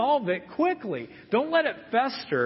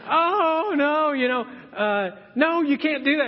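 a hammed-up child begging, pitched up; kid's mock panic; 5 semitones higher than usual. Child begging mom not to pull splinter, mock panic. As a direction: plead like a scared kid dodging tweezers. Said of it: "But, uh".

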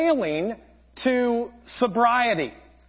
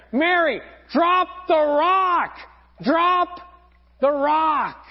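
yelling; 6 semitones higher, slower than usual. Retold or imitated. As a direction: yell out to a swimmer far away. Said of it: "they start yelling out to Mary".

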